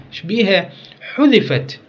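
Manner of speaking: ordinary talk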